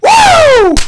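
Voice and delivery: a huge high whooping cheer; wild woo. Real, not acted. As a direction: whoop at the top of your lungs. Celebrating the knockout.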